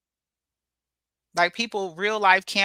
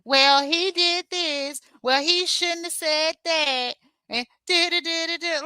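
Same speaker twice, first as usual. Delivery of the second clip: slow, higher sing-song parody of their excuses; mock the lame excuses, slow and higher; 7 semitones higher, slower than usual. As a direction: drag it out like a whiny excuse-maker. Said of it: "they was making excuses".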